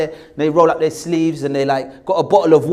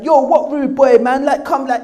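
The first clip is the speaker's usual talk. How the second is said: mock rude boy, high and loud; 9 semitones higher, 6 dB louder than usual. Nervous kid mimicking the rival boys auditioning, loud rude boy. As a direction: overdo a big, loud street kid. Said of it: "he's very loud, he's very big".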